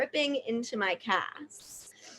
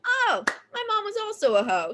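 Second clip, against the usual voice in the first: high-pitched gleeful "oh" of discovery; dawning realization; 11 semitones higher than usual. Retold or imitated. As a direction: act out the penny dropping, pitched up. Acting out sudden realization.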